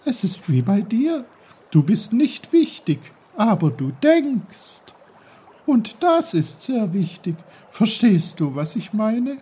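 high, bubbly babbling brook; lively brook voice. Acted. Brook character speaking to Peter.

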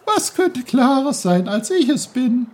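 high, loud, offended boast; the brook, peeved, squeaky and loud; indignant brook. Acted. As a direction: puff up like a stream insulted about its clarity. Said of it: "sprudelte der Bach ärgerlich".

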